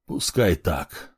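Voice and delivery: quiet, slow, swallowing tears; holding back tears. Acted. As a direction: mumble it through tears. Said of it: "проглатывая слёзы, забормотал он".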